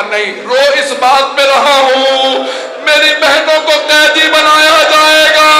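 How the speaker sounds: high, loud, slow wail; wailing lament. Acted. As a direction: cry it out slowly to the grandfather. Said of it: "Hussain रोते हुए उठे".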